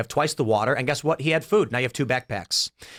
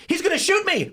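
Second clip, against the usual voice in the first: high, alarmed inner voice of the stranger; he's sure he's about to be shot; panicked thought; 3 dB louder than usual. Retold or imitated. Stranger voiced in alarm as a gun is raised at him. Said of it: "he thinks".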